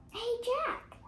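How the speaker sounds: high bright call across the hill. A narrator's reading. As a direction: call out to him. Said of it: "Annie called".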